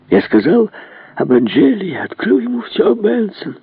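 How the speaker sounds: distraught prince, voice climbing high; князь в смятении. Acted. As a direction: confess in mounting dismay, pitch soaring. (князь в смятении, голос всё выше)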